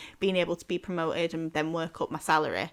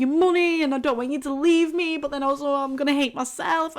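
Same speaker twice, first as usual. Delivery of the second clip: half-hearted panicky wail, higher; mock crying rant; 5 semitones higher, 3 dB louder than usual. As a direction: replay the meltdown lightly, pitched up. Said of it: "because I was just crying and being like".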